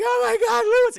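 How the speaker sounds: very high, loud cry of alarm; mock horrified scream. Anna watches Lewis bleed out, mock scream. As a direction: shriek his name in acted horror. Wife watches husband die.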